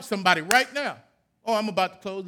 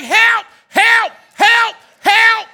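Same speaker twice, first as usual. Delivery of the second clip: high, loud desperate shout for help; crying for help; 9 semitones higher, 11 dB louder, much slower than usual. Pretend. Preacher pretending to be trapped in a burning building, shouting for help. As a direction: yell for help like you're trapped in a fire. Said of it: "If I'm in a burning building".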